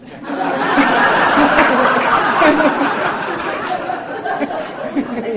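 loud open laugh; big unvoiced laugh; burst of laughter. Real, not acted. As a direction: laugh out hard. Audience laughter after line.

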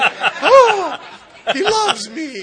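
mock swoon, high, loud and laughing; swooning girlfriend. Girlfriend swooning over the guy she just met, loud and high. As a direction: gush like a lovestruck girl.

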